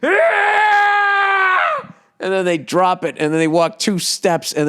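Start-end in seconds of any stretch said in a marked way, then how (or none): 0.0-1.9 loud, high roar of effort; mock weightlifter yell. Comic mimicking gym lifters, a big straining roar. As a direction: bellow like a gym bro after a lift. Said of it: "they just go like".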